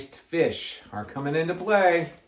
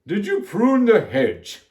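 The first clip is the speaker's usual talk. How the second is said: slow, loud, higher character voice; Miss Ralph; 5 semitones higher, 5 dB louder, slower than usual. A narrator's reading. Miss Ralph questions Amelia Bedelia, put-on slow voice. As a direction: ask it slowly in a raised voice. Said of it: "she asked".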